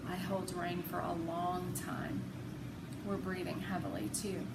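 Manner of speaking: ordinary delivery, nothing marked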